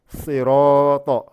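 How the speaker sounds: sirata with a heavy sad and ta, slow and loud; swollen sirata. Shown as a demonstration. Teacher making students hear the swollen letters, emphatic. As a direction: round out sirata full and heavy. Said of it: "le sad on gonfle bien".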